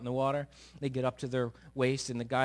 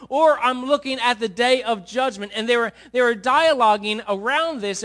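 full-force preaching; 7 semitones higher, 9 dB louder than usual. Acted. Pastor urging the congregation to live for God, preaching hard. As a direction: drive every word home to the pews.

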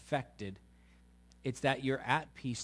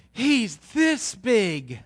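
high, loud, slow wonder; high slow loud child awe; awed little boy; 9 semitones higher, 12 dB louder, much slower than usual. Retold or imitated. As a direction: marvel at how big daddy is. Child voiced in story.

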